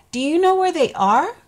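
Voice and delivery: high, quick, loud pleading question; anxious spider. Acted. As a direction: ask fast and worried.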